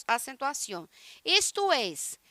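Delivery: ordinary talk, nothing marked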